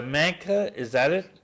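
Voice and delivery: ordinary talk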